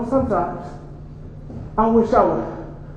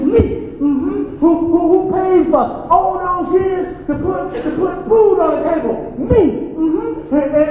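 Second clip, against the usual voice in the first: put-on boastful drunk father; 4 semitones higher than usual.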